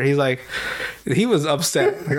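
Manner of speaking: usual speaking voice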